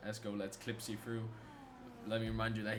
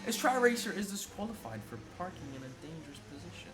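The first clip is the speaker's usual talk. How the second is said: higher and louder rapid results call; rising excitement; 9 semitones higher, 4 dB louder than usual. Real, not acted. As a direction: ramp up as the times land. Live qualifying results flood in.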